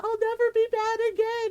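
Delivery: put-on high, frantic little-boy pleading; mock shrieking. Little brother bargaining with the aswang, mock shrieking. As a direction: promise desperately like a scared child. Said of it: "and he's shrieking".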